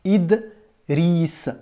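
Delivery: Idriss split as Id, riss, with a bare d; no vowel after D. Shown as a demonstration. Teacher asks pupil to hear a vowel, saying Idriss split after d. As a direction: stop Idriss on the d, then stretch riss. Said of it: "Est-ce que tu entends une voyelle".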